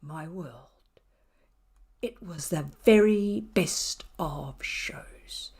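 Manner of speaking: hushed, whispered telling; spooky whisper. A narrator's reading. Narrator whispering the cat's spooky show. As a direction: whisper it like a secret.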